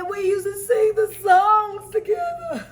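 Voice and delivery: high, slow, loud tearful wail; mock crying. Co-host acts out the character's crying scene, mock wailing. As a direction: drag it out like a hammed-up sob. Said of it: "when she cried".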